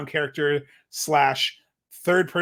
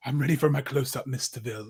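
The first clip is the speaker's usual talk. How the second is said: a hint of an aging Broadway diva, fading softer; lightly voiced grand old actress; old-fashioned diva; 3 semitones lower, 4 dB quieter, faster than usual. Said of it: "Margot is this aging Broadway actress".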